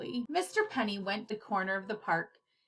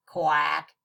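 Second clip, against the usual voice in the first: quack; 7 semitones lower, 6 dB louder than usual. Acted. Retake quack.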